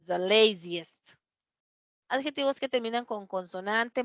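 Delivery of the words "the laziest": drawn-out, careful English; slow model phrase. Shown as a demonstration. Teacher showing the comparative form to class, slowly.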